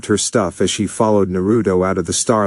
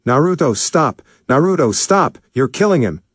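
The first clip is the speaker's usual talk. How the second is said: frantic shout; 6 semitones higher than usual. A narrator's reading. Caitlyn shouting into the intercom, high and frantic. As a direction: yell through the intercom to stop the beating. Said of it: "Caitlyn shouted into the intercom".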